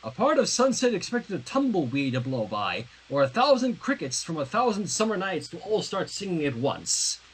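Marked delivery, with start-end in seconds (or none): none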